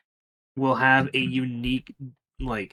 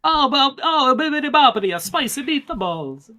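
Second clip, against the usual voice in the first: hammy Italian voice, high and loud; mock Italian chef accent; 12 semitones higher, 6 dB louder than usual. Mock Italian after pasta joke.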